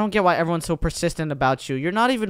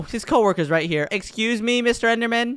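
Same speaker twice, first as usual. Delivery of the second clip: ordinary talk, nothing marked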